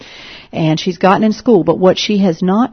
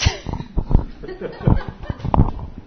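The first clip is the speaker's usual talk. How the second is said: laugh; 4 dB quieter than usual. Real, not acted. Laughs at her own story.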